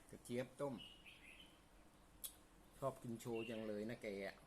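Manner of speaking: talking while chewing; words round a bite of food; mouth full. Real, not acted.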